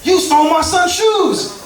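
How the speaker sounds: high, slowed furious accusation from the mom; hammed-up outrage, half laughing; mock angry mom. Comic imitating the angry mom on the phone, mock furious. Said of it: "She says".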